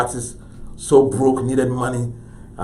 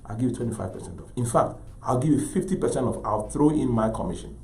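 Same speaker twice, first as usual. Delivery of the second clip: soft, measured sincerity with long pauses; quietly firm, heartfelt appeal; speaking from the heart; 8 dB quieter than usual. Retold or imitated. Gallery dealer to senator, championing his artist, soft and sincere. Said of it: "I saw Leroy speaking his heart".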